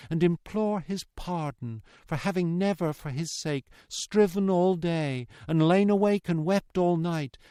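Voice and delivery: earnest plea swelling, then hushed refrain; emotional daughter's entreaty; pleading daughter. Acted. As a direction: implore him, then give thanks tenderly.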